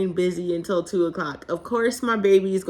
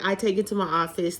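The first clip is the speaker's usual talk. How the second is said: ordinary talk, nothing marked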